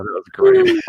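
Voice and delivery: high delighted squeal. Real, not acted. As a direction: squeal it with glee.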